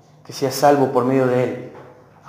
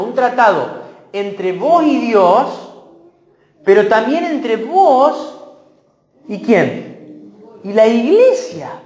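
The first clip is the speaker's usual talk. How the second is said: fervent preaching; 6 semitones higher, 3 dB louder than usual. Acted. Pastor drives home the covenant to the church, fervent preaching. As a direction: preach it out with full force to the congregation. Preaching rises to full force.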